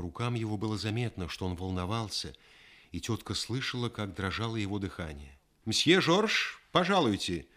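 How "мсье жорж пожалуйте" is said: called out loud from behind a door; a loud summons; shouting. Acted. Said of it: "крикнул кто-то за дверью".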